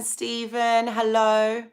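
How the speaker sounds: ordinary delivery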